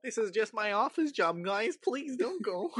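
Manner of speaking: high, drawn-out plea; helpless little office guy pleading, slowly; pleading office worker. Acted. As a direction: beg them pitifully in a high voice. Acts out pleading office worker in dream.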